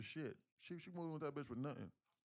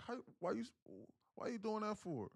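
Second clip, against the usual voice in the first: high, put-on mom voice asking why; imitating mom; 9 semitones higher than usual.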